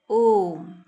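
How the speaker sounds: ओम with the O held long and loud; prolonged vowel. Shown as a demonstration. Teacher showing pupils the pluta swar, ओम drawn out long. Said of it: "थोड़ा लंबा बोला जाता है".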